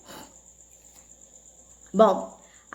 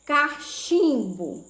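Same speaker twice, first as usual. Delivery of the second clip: slow dictation; 2 semitones higher, much slower than usual. Shown as a demonstration. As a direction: draw out the middle of "cachimbo". Teacher introduces word to split.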